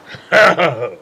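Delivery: a big hearty burst of laughter; booming delighted laugh; loud laugh. Acted. Stranger reveals origin, hearty laugh.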